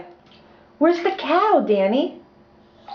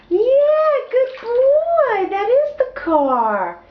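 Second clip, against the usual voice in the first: excited praise; 12 semitones higher, slower than usual. Real, not acted. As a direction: cheer him on, beaming. Praising toddler's correct answer.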